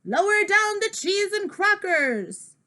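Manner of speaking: pirate voice. Acted. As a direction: holler it like a goofy pirate. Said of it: "I talk like a pirate".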